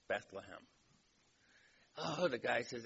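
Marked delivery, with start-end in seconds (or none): 0.1-0.7 a flat, simple statement, a little softer; just state it, no fuss; matter-of-fact. Retold or imitated. Jesus answering the club member's question, matter-of-fact. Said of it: "Christ matter-of-factly says".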